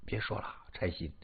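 low, very soft elder; soft, low plea to stop; subdued hushing. Acted. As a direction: quietly cut him off, low and weary. Father-in-law hushes the weeping man.

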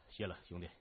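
low, quiet, understated thanks; cool gang boss. A narrator's reading. Gang boss to policeman unlocking his handcuffs, cool and quiet. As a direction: thank him coolly, low and soft.

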